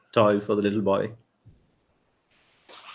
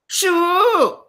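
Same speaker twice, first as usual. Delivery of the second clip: yelled shoo. A narrator's reading. Mother chasing a stray from her child, high yell. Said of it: "yelled a mother".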